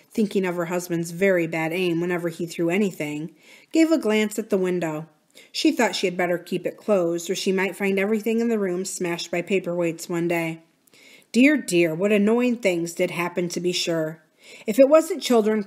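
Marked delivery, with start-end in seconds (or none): none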